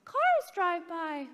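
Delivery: put-on kid voice. Kids answering the teacher.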